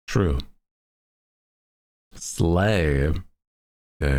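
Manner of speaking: ordinary delivery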